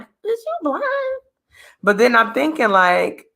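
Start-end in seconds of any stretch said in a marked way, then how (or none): 0.0-0.8 high, stunned disbelief at her husband; can't believe what she's hearing; incredulous sass. Retold or imitated. Wife doubting her husband's compliment, incredulous sass. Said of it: "I'll be looking at him like".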